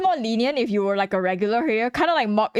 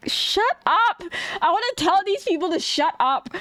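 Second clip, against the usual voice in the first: mock shout; 9 semitones higher than usual. Hanging out in a friend's stream, fed up with chat, yelling shut up. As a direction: blurt it out like snapping at chat. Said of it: "I'm like".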